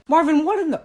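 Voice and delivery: high, loud startled cry; shocked shout. Acted. Friend caught off guard by Marvin, high shocked yell. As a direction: squeal his name in disbelief.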